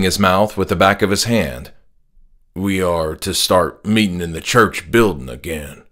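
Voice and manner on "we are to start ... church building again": lower, steady voice of a devout dad; father announcing his new calling, firm and low; stern religious father. Acted. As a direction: speak as the dad, sure of his faith. Father's voice in story.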